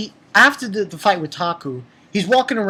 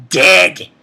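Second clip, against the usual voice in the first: loud dead; 8 dB louder than usual. Real, not acted. Ranting at villain.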